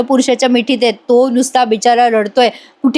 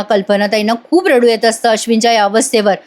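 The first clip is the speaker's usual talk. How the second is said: ordinary talk, nothing marked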